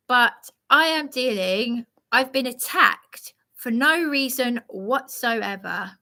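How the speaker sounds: ordinary delivery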